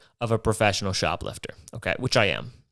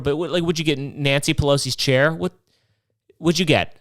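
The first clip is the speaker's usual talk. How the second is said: normal talking voice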